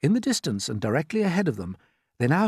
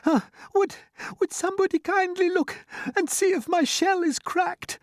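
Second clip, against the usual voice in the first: very high fretful voice; worried plea for help; anxious ladybird. A narrator's reading. Ladybird asking others to check her shell, anxious. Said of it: "the ladybird said".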